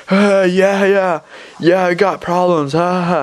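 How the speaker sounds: put-on high, drawn-out drunken voice; imitated laughing drunk. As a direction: mimic a drunk laughing along. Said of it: "He's, like, drunk".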